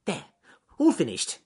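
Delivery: high, loud, satisfied rabbit; Ernest Fluffy Rabbit. Acted. Rabbit done with his leaves, high and pleased. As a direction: announce it brightly in a high rabbit voice.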